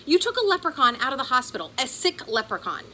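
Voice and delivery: high screechy disbelief, words tumbling fast. A narrator's reading. Ben scolding Pearl about her terrible plan, screechy and high. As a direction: squeak it out in panic. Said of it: "Ben's voice got kinda screechy".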